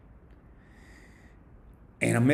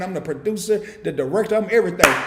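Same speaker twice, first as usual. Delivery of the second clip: ordinary delivery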